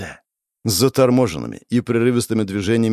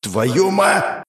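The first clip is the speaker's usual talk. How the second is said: a higher outburst broken off mid-word; yelling curse, cut off; 9 semitones higher than usual. A narrator's reading. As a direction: start to roar and get cut off. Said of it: "начинаю орать я".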